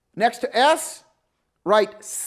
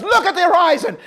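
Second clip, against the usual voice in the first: loud, high repeated orders; shouted commands; 9 semitones higher, 5 dB louder than usual. Retold or imitated. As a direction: hammer the orders at a panicking student. Instructor repeating.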